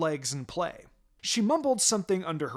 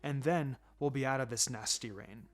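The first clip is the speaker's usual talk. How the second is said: reassuring; 5 semitones lower, 5 dB quieter than usual. Acted. Mother comforting daughter.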